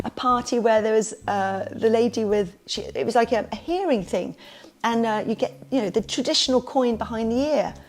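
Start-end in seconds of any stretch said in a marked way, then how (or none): none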